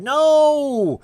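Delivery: high, loud, drawn-out wail of dismay; anguished mock cry. Man finding grey in his beard, theatrical anguished yell. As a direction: cry out in hammed-up horror.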